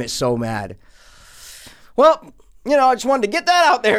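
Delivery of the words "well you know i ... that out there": worked up. Real, not acted. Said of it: "You did some laps".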